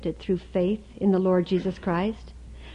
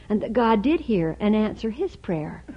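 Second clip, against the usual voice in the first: ordinary talk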